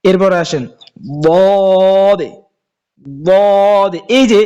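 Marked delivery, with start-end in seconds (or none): 1.2-4.0 "দদে" stretched long and thick; heavy dad. Shown as a demonstration. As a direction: hold the "দদে" heavy and slow. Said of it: "এই দদ হরফটা".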